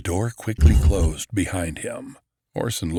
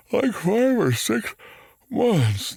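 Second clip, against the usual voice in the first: yawning; 13 semitones higher, 3 dB louder, slower than usual. A narrator's reading. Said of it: "the bear yawned".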